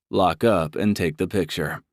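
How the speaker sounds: ordinary delivery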